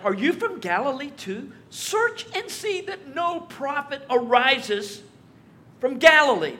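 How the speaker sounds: scoffing retort. Retold or imitated. Pharisees scoffing at Nicodemus, high and mocking. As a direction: sneer at the idea.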